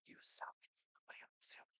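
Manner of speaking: hushed, breathy whisper of a taunting demon. Retold or imitated. Demons whispering insults into your mind, in a whisper. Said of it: "they're whispering".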